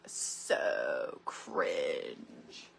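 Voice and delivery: low, unvoiced mock teenager; mock teen drawl. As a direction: sneer it like a bored teen.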